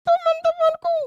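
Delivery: put-on very high child puppet voice, whiny and loud; a hurt little girl complaining; Susan puppet voice. Puppet girl Susan tells the kids she was teased, in a very high whiny voice. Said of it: "ini namanya Susan".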